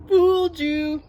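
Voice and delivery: high, loud gotcha; Ernie. A narrator's reading. Ernie reveals the prank to Bert, high and gleeful. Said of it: "laughed Ernie".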